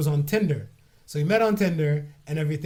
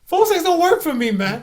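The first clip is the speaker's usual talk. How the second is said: emphatic; 11 semitones higher, 7 dB louder than usual. Real, not acted. Emphatic denial.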